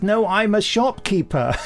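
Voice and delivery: mock Hitler denial. Hitler captured, denying who he is.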